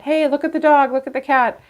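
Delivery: high, bright mock texting voice; chatty messenger imitated. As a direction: play the friendly texter showing off pets. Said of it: "they may send you pictures of them".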